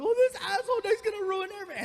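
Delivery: very high, rapid mock wailing; hammed-up female hysteria, racing upward; hysterical mom. Girlfriend reading the dad's email, comically hysterical. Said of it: "his mom getting hysterical".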